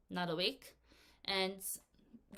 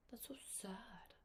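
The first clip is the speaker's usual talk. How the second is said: gasped, breathy whisper of pity; mock sad; 2 semitones higher, 18 dB quieter than usual. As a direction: whisper it as if heartbroken. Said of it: "gasp then sad whisper".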